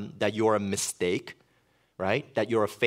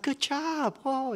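teasing praise; much slower than usual. Retold or imitated. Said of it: "And I was like".

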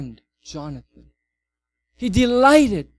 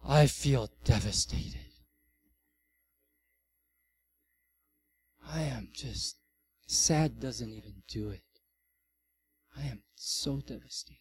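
a hushed, heavy mock despair; acted despair; 5 semitones lower, 6 dB quieter than usual. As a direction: let the words fall away in quiet grief. Acting out deep despair.

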